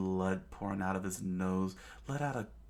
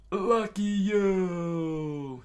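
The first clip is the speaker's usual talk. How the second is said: high, loud, very drawn-out cry; long high holler that slowly falls; screamed out; 11 semitones higher, 6 dB louder, much slower than usual. A narrator's reading. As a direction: scream it, stretching every vowel. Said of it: "he screamed out".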